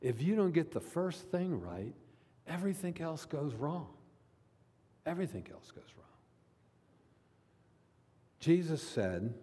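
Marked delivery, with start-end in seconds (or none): 5.1-6.1 quick, near-whispered echo; hushed repeat. Real, not acted. Preacher repeating his warning to the congregation, hushed.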